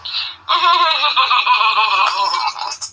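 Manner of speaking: long real laugh. Real, not acted. Old woman laughing at her own joke, real laugh. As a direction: let the laugh roll out.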